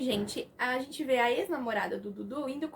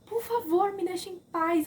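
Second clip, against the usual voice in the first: retold shout; 6 semitones higher, 4 dB louder than usual. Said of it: "ela grita com eles".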